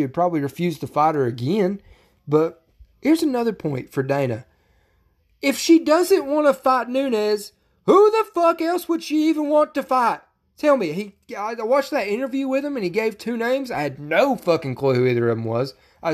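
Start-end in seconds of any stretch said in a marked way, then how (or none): 5.4-10.9 higher, louder, slow angry rant; getting pissed. Real, not acted. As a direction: vent at the promoter, fed up. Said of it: "'cause I'm getting pissed".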